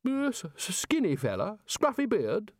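high, scornful, rising voice; scornful Arch-Chancellor. Acted. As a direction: sneer at the useless fellow. Ridcully recalls Rincewind.

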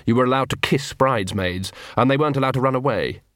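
normal talking voice

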